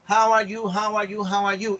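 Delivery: rote repetition. Retold or imitated. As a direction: parrot it identically, like a recorded habit. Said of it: "porque están grabadas en tu chip". (repetición mecánica, como grabada en un chip)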